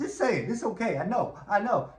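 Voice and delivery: rising insistence. Retold or imitated. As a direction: press her harder each line. Pearl insisting.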